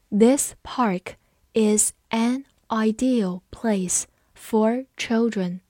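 slow, with a pause between word groups; slow read-along. Shown as a demonstration. Teacher leading students through the park sentence, slowly. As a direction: read slowly so learners can follow. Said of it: "我们慢慢来读".

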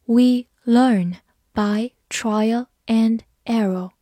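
slow, careful read-aloud of the sentence. Shown as a demonstration. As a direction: read it slowly for students to follow. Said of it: "我们慢读一遍".

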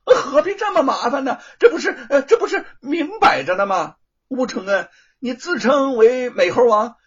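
loud, high, mocking laughter in it; blustering sophistry. A narrator's reading. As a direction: bluster to twist the facts. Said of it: "罗庞强词夺理地说".

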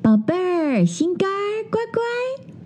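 mother bear softly calls her cub; tender calling. Acted. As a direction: coax the baby awake gently. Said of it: "熊妈妈呼唤道".

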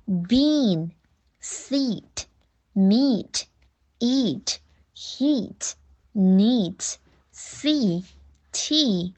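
word list with a long tense ee in each; careful model reading, long vowels held; long E drill. Shown as a demonstration. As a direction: read each word apart, stretching the ee. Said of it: "长音的E发".

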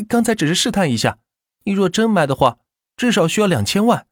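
sly seller upping the price; shop owner voice. Acted. Shopkeeper bargaining.

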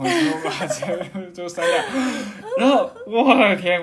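high, loud laughter breaking into the words; real rueful laughter at his own mistake; laughing. Real, not acted. Laughing at own careless deletion.

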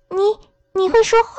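高声惊呼，不敢相信; 音调猛地拔高，满脸吃惊. A narrator's reading. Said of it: "小女孩惊讶地问". (小女孩惊讶地高声发问)

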